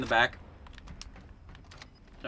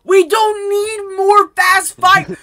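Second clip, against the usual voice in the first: exasperated yelling; 11 dB louder than usual. Real, not acted. Player ranting about new guns, exasperated yelling. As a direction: shout each word out in frustration.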